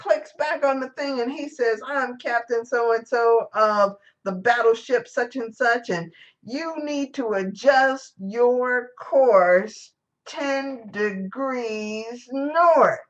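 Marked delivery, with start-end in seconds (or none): 1.8-13.0 slow, drawn-out commanding order; pompous angry captain. Retold or imitated. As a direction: spell out each word like an offended captain. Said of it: "the captain is getting pissed off".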